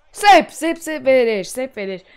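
loud, high cheering; excited praise. Real, not acted. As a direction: cheer the save loudly. Praising the keeper's save.

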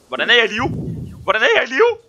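loud surprised disbelief; amazed. Real, not acted. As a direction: cry out in disbelief. Surprised at surviving.

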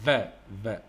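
"ذا" voiced as English "v", lip on teeth; V sound demo. Shown as a demonstration. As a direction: say "ذا" as an isolated "va", twice. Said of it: "يقول من ننطق صوت ال".

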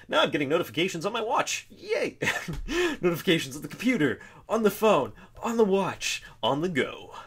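high, fast, mock-excited list; playfully exasperated cheer; mock excitement. Real, not acted. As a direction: rattle it off in mock glee.

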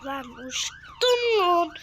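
surprised cry. Real, not acted. Gamer cries out in surprise as his character flies. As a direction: let it out as you go flying. Flung into air in game.

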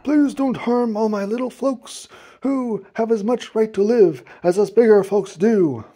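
high, pressing plea; begging. A narrator's reading. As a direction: beg earnestly for the little ones. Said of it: "and begged".

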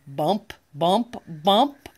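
ordinary delivery

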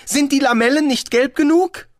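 loud, high eager question; rufen. A narrator's reading. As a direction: call it out in a high tourist voice. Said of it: "rief Zweiblum". (laut und hoch nachgefragt)

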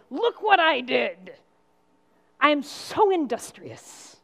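slow, loud, smug bragging about the barley; mock self-pride. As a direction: show off your hard work, hammed up. Preacher mocks her own pride.

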